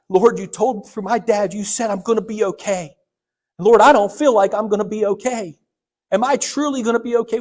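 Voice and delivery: loud, high, pleading cry at God; angry prayer. Retold or imitated. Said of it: "so angry".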